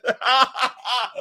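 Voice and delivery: high-pitched helpless laughter; long high cackle; real laugh. Real, not acted. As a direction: crack up at a friend's joke. Cracking up at chat joke.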